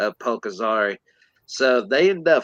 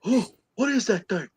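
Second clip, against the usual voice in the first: alarmed order; 9 semitones higher than usual. Retold or imitated. Acts out men reacting to creature.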